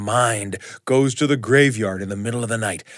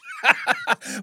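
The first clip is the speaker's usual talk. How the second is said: high wild cackle; ghostly cackle. Acted. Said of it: "he cackled".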